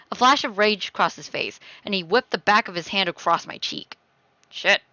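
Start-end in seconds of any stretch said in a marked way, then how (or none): none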